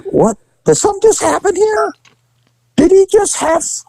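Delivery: high, confused double take; startled cop unsure what he saw; baffled. Retold or imitated. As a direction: play him baffled, wondering what just happened. Confused guy wondering what happened.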